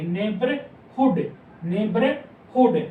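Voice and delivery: slow, syllable by syllable नेबरहुड with a clear h before हुड; teacher spelling out नेबरहुड slowly, sounding the h; careful demo pronunciation. Shown as a demonstration. Shorthand teacher to students, slow clear demo of the word. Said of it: "इस type से हम इसको pronounce करेंगे".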